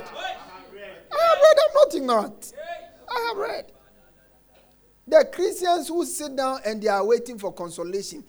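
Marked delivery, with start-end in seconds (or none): 0.0-3.7 high sing-song boast. Real, not acted.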